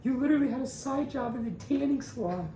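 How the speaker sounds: high, drawn-out teasing wonder; mock awe. Real, not acted. Ribbing his best friend about the tanning job, high and mock-amazed. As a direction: marvel at him like a myth.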